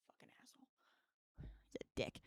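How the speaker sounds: unvoiced whispered grumble at the cat; whispered insult. Real, not acted. Streamer whispering an insult about her cat. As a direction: breathe the insult under your breath.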